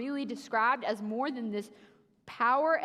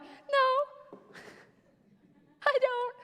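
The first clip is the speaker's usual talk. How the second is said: very high, small and laughing; timid squeak; 12 semitones higher, much slower than usual. Retold or imitated. Me answering God, high nervous squeak. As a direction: squeak it out, terrified. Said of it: "I would have been like".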